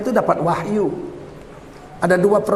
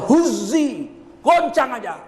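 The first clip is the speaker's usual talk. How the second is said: higher-pitched, emphatic order; brisk command; 4 semitones higher, slower than usual. Retold or imitated. Preacher voicing God's order to shake the tree, emphatic command. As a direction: give it as a no-argument instruction.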